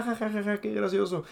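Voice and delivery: high mocking kid laughter, ha ha ha; mock kids laughing. Kids of ninety-seven laughing at the cartoon, high mock giggle. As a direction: imitate amused nineties kids cackling at the joke. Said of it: "los niños en ese tiempo lo veían como que".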